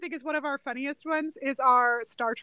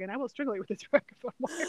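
softer, lower, understated movie threat; quiet threat; 4 semitones lower, 7 dB quieter than usual. Retold or imitated. Quoting the wedding singer's threat to strangle someone, very quietly. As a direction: imitate a hushed threat with a laugh slipping in. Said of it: "he says it so quietly".

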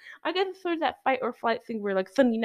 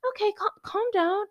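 high mocking nurse voice; sarcastic high nurse imitation; 10 semitones higher than usual. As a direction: mimic the dismissive nurse up high. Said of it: "and she's like".

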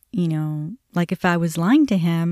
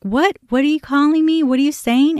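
higher, louder confused demanding; agitated son; 8 semitones higher, 4 dB louder than usual. Retold or imitated. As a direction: rush in puzzled and insistent.

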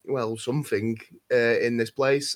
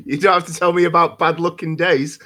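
chuckling through the words; laughing; 4 semitones higher, 6 dB louder than usual. Real, not acted. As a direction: laugh while you say it.

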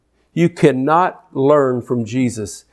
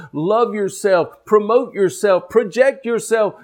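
higher-pitched preaching, climbing to a peak; building sermon crescendo in rhythmic repeats; impassioned preaching; 6 semitones higher than usual. Real, not acted. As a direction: drive each phrase home, drawing out the key words. Builds to full preaching force.

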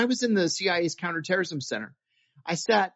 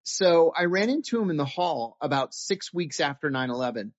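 ordinary talk, nothing marked

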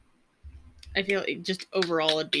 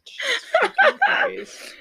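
high, loud, slow exclamation cracking into laughter; laughing disbelief; 8 dB louder, slower than usual. Real, not acted. Reacting to a wild internet comment, laughing in disbelief. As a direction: blurt it out, half laughing, half shocked.

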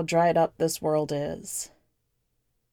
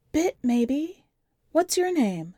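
put-on higher young girl's voice, plain and matter-of-fact; the kid's voice; 8 semitones higher, slower than usual. A narrator's reading. The kid telling the stranger about her parents, in a higher child voice. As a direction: lift the pitch to play the nine-year-old.

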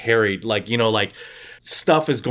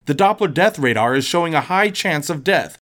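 bright, higher TV weatherman read; cheesy weather report; 6 semitones higher than usual. Acted. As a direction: sell the forecast like a local news weatherman, Al Senseless.

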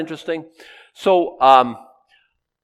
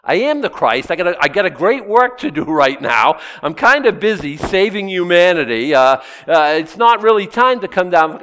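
loud, high hammed-up Christ; mock Christ reply; 6 semitones higher, 7 dB louder than usual. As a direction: play it big and loud, almost comic. Voicing Christ on the cross.

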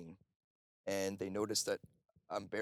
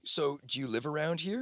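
high, louder Australian-style question; imitated Australian accent; 7 semitones higher, 6 dB louder than usual. Stranger asks where the speaker lives, imitated Australian accent. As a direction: lightly mimic a curious Australian, pitched up. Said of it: "with this Australian accent".

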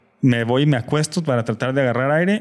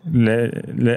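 usual speaking voice

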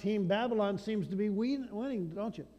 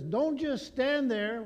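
louder, higher, slow panicked urging; panicked call; 7 semitones higher, 6 dB louder, slower than usual. Acted. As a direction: urge them on like a frightened crowd. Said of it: "The world panics and says".

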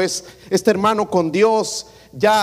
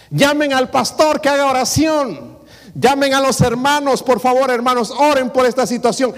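higher, louder, hammed-up call for the pastor; mock panicked calling; 8 semitones higher, 4 dB louder than usual. Preacher imitating a family in crisis, loud mock cry for help. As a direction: play a frantic believer shouting for prayer.

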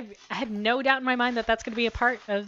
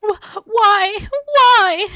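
high, loud tearful groaning cry; angry sobbing; 13 semitones higher, 16 dB louder, much slower than usual. Acted. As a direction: rage through the tears. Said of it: "she groaned angrily around her tears".

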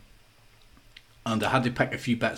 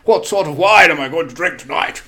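put-on higher, louder pompous old gent; posh old man; 4 semitones higher, 7 dB louder than usual. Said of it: "doing my posh old man".

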